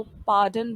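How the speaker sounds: पार्डन said as paa-dun, no r sound; पार्डन with the r dropped; silent r. Shown as a demonstration. Teacher modelling पार्डन for learners, r left silent. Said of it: "आर को silent रखते हैं".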